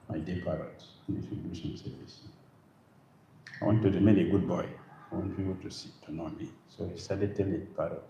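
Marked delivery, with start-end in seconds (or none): 0.1-6.5 hushed aside. Acted. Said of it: "Krishna called the parrot".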